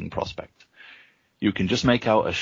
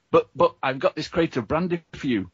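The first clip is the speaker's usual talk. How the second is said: higher, hesitant stammering; flustered, higher-pitched protest; nervous stammer; 8 semitones higher than usual. A narrator's reading. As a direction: stammer out a timid excuse. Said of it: "you manage to stammer".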